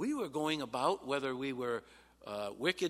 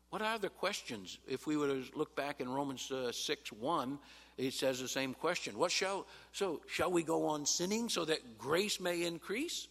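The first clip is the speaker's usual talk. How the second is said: ordinary talk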